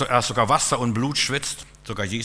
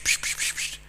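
whispered hissing; 4 dB quieter than usual. Retold or imitated. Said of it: "Immer nur psch".

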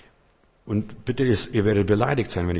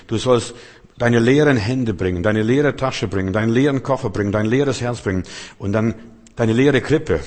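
loud preaching; 5 dB louder than usual. Acted. Preaching at full force.

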